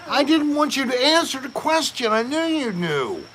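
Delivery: high-pitched playful exasperation; mock scolding. Host chides companion who spoiled the quiz, mock scolding.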